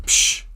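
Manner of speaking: loud, sharp shush; forceful "pshh"; hushing. Acted. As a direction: cut off the chatter with a shush. Hunter hushing his wife.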